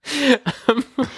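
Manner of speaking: high giggling laugh. Real, not acted. Laughs at own mistakes.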